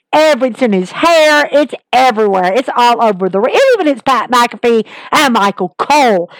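ordinary delivery

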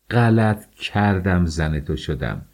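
slow, deliberate, spaced words; wife telling her husband slowly and deliberately; measured words. Acted. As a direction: spell out each word coldly. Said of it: "با کلماتی شمرده گفتم".

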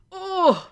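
high, aching "oh"; wounded little moan of sympathy; pained "oh". Real, not acted. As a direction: let out a high, heartbroken oh. Imagining a heartbreaking goodbye.

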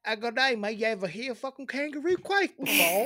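loud, high hammed-up Aussie; badly faked broad Australian accent. Host retries the kangaroo question, Australian accent. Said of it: "how's an Australian sound?".

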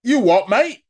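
loud, high mock British "You what, mate?"; British accent. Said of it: "with a British accent".

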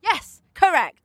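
ordinary delivery

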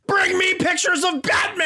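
high, shouted demand; full-tilt hammed-up editor yell; J. Jonah Jameson. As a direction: bellow it like an irate newspaper boss. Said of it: "J. Jonah Jameson".

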